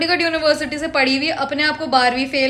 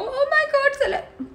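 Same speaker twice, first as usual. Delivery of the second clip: much higher, squealing mock fangirl; mock starstruck fan; 12 semitones higher, much slower than usual. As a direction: gush like a dazzled fan, in jest.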